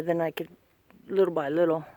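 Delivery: ordinary talk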